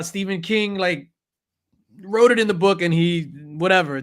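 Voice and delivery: ordinary delivery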